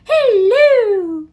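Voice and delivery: high, loud, drawn-out greeting; over-the-top high-pitched dame voice; Mrs. Doubtfire. Acted. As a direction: sing out a long high hammy hello. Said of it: "except for when she's like".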